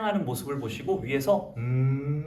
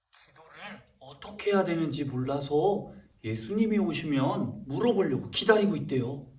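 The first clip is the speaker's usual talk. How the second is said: normal talking voice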